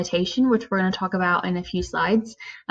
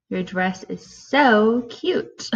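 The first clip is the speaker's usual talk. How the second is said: slow, drawn-out sarcastic "so cute"; sassy, negative; 2 semitones higher, much slower than usual. Shown as a demonstration. Teacher demonstrating the mean way, slow and sassy. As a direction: drag out "so" like you don't mean it. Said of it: "Or in a negative way, you can say".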